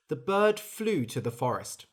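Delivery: ordinary delivery, nothing marked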